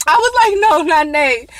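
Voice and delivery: high, loud pleading no; panicked. Retold or imitated. Panicked about character.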